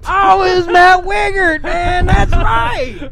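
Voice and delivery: high, shouted, drawn-out impersonation; very high, loud caricature; Matt Wingard. Acted. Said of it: "That's who you sound like".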